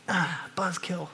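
a slow, deflated mock grumble; mock disappointment. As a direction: sulk at being told no. Disciples let down by Jesus.

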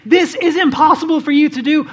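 ordinary delivery

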